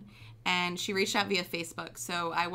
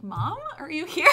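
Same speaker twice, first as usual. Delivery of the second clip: acted wonder; 12 semitones higher, 15 dB louder than usual. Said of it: "I was like".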